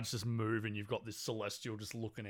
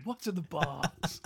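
Brad Pitt; slower than usual. Retold or imitated. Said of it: "Where was Brad Pitt?".

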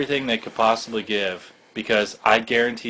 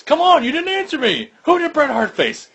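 much higher, pestering commentator impression; Lawler. Commentator pressing the boss who ignored him, high mock pestering. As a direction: whine for an answer, high-pitched. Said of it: "And then Lawler goes".

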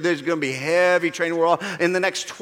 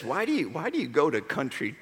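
mock family member; 4 dB quieter than usual. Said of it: "My family says".

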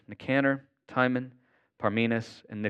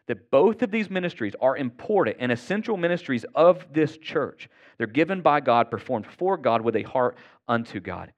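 preaching; 4 semitones higher, 3 dB louder than usual. Acted. Preaching climax on ministries.

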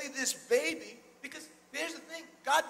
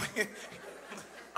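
chuckle; 7 dB quieter than usual. Real, not acted. Laughs at own joke.

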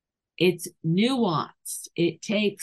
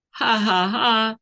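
gloating laugh; 5 semitones higher, 5 dB louder than usual. Shown as a demonstration. Gloating at finding cat.

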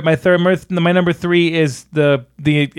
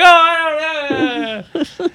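high, very drawn-out wail; mock helplessness; 11 semitones higher, much slower than usual. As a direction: imitate him, stretching the words out high. Mimicking film character, laughing.

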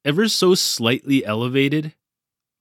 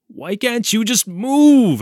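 high, louder, drawn-out exasperation; choked plea; 9 semitones higher, 6 dB louder, slower than usual. A narrator's reading. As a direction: plead in helpless frustration, pitched up. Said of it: "I choked at the snake".

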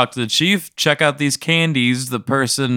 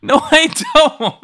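high, loud, drawn-out protest; protesting yell; 5 dB louder than usual. Acted. Player refusing to be held up any longer, high protesting yell. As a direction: wail the refusal, half laughing.

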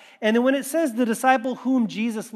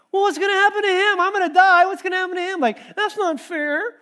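much higher, louder panicky whine, slowing at the end; whiny Peter; 11 semitones higher, 5 dB louder than usual. Pretend. Pastor mocking a whiny Peter asking Jesus about John, high and panicky. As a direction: play Peter as a fretful complainer. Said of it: "I don't think that was the tone".

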